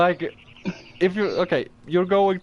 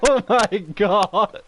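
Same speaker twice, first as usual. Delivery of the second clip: laughing; 6 semitones higher, 3 dB louder, slower than usual. Real, not acted.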